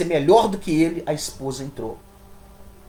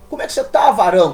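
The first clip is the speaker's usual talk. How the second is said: much higher, louder fake hearty greeting; mock cheerful greeting; 3 semitones higher, 8 dB louder, faster than usual. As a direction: ham up a falsely cheerful churchgoer hello. Mocking a hypocritical churchgoer greeting. (saudação falsamente animada, bem mais aguda e alta)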